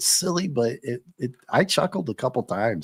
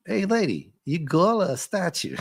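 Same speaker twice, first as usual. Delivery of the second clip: higher, goofy Brooklyn tough-guy voice; New York goil accent; 6 semitones higher than usual. Retold or imitated. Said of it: "That's how he says, "Girl".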